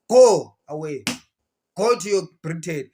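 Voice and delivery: loud, sharp go away; shouted dismissal. Real, not acted. Ordering the British out of Zimbabwe, loud shout. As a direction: drive them off with a loud command.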